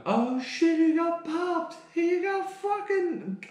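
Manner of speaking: very high, stretched jeering voice; mocking bystander. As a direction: imitate the laughing onlookers. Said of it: "they're laughing, going".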